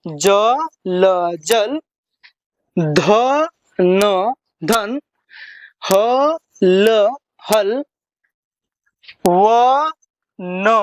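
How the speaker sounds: slow, each letter sounded before the joined word; teacher sounding out two-letter words slowly; letter by letter spelling. Shown as a demonstration. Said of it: "हिर्जा लगा के उच्चारण".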